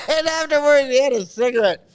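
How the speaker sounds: high voice breaking into laughter; laughing hard. Real, not acted. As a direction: struggle to finish the joke through laughs. Cracks up at his own joke.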